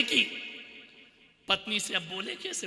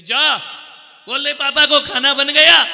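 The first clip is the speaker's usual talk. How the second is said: mother yelling across the house; loud shouting; 7 semitones higher, 14 dB louder than usual. Acted. Mother sending son to call papa to dinner, shouting. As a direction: yell a command to be overheard. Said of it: "इतनी जोर से बोल रही है".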